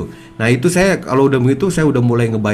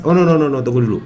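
firm refusal; 2 semitones higher, 4 dB louder, faster than usual. Acted. Acted refusal of the devil's bypass.

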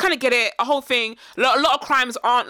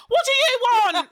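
much higher and slow, laughing through it; a nervous host barely holding back laughter; laughing mock timid; 11 semitones higher, slower than usual. Podcaster playing a timid homeowner meeting an intruder, laughing.

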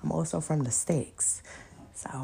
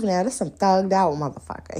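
ordinary delivery

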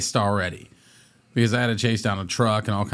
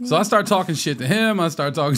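ordinary delivery

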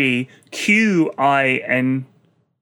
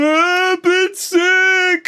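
high, slow wail from upstairs; screaming; 3 dB louder, much slower than usual. Retold or imitated. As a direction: scream it out like a sick kid. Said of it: "Suddenly my daughter starts screaming from".

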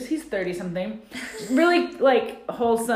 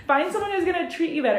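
much higher, louder giggly advice; laughing; 11 semitones higher, 5 dB louder than usual. Real, not acted. Advising Angela via the cohost, laughing.

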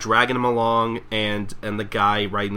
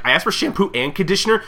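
complaining customer; 7 semitones higher, faster than usual. Acted. Customer complaining, acted quote.

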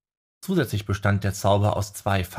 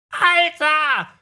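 very high, loud delighted little owl squawk; excited owl crow; 6 dB louder than usual. A narrator's reading. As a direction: crow it out with joy like a tiny owl. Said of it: "krähte dieser begeistert".

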